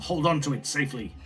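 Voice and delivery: usual speaking voice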